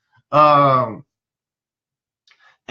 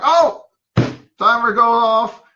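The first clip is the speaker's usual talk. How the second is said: high, loud "oh", feigned surprise; mock surprise; 7 semitones higher, 4 dB louder than usual. Therapist tells a gaming boy time is up, high mock surprise. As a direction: playfully announce it, pitched way up.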